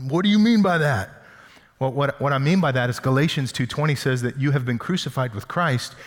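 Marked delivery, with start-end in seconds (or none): 0.1-1.1 mock indignant retort. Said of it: "we're like".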